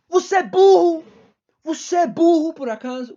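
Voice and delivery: high, loud mock outrage; exasperated scolding. Mock-scolds those who split up.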